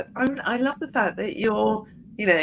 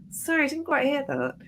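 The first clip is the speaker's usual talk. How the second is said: mock polite sorry; 7 semitones higher than usual. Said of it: "that real thing where you go".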